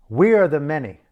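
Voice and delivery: we are the many; rallying shout. Real, not acted. Speaker rallying listeners to fight back, loud rallying shout. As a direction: call it out loud like a rally cry.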